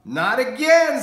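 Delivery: high loud laughing cry; laughing exclamation. Acted. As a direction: laugh it out in mock dismay.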